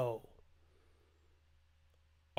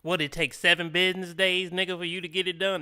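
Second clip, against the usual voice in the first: higher and louder, full of sass; lot more attitude; 4 semitones higher, 4 dB louder than usual. Retold or imitated. Host imitates an annoyed girlfriend scolding her man, loud and sassy. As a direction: throw the line at him like an annoyed girlfriend. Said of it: "she gonna be saying that with a lot more attitude".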